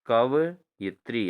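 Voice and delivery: कवयित्री said slowly, syllable by syllable; teacher drawing out कवयित्री; slow model word. Shown as a demonstration. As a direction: spell out कवयित्री slowly for the class. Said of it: "क्या बन जाएगा दोस्तों?".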